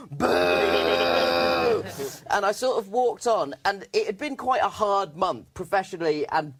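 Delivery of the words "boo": a low, drawn-out heckler's boo; mock crowd boo. Heavy metal fans booing the comic off stage, low jeering boo.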